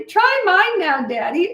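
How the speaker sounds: put-on high, slow little child's plea; high childlike voice, stretched out slowly; little girl voice. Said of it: "So I'd say".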